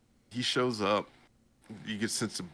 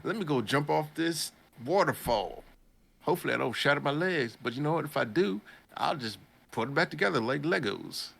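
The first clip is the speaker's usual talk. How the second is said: raised, breezy voice of a careless hero; lightly voiced cocky hero; 7 semitones higher than usual. Voicing the boy planning his waterfall jump, lightly raised. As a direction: toss it off like a reckless kid. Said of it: "my boy's like".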